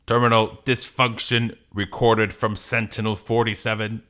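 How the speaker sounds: even mechanical report; robot voice. Acted. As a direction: log the fault like a machine. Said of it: "Are you gonna do a robot voice?".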